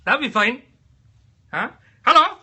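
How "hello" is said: a high, loud squeaky hello; high, loud mock greeting; silly squeaky hello. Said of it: "at least do something like this".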